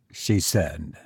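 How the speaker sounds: ordinary delivery, nothing marked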